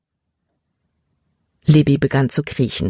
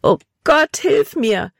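high, desperate plea; high-pitched, pleading call; desperate cry; 9 semitones higher, slower than usual. A narrator's reading. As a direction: cry out for help in despair. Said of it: "rief sie verzweifelt".

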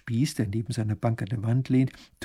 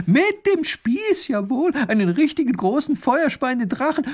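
very high, emphatic outburst; agitated insistence; 13 semitones higher, 5 dB louder than usual. A narrator's reading. Wife overriding her husband's doubts, agitated. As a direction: drive each phrase home, pitch way up. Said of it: "wird die Frau noch aufgeregter".

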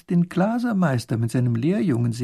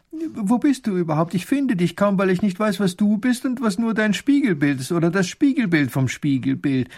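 high, excited grand duke voice; delighted little ruler lost among his reflections; 7 semitones higher than usual. Acted. Grand duke searching among the mirror images, high and animated.

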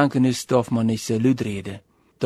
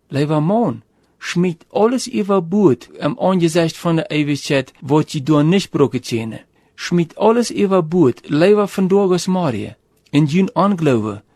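earnest urging; 4 semitones higher than usual. Acted. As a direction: push him to throw it overboard, urgently. Preacher urging dying man.

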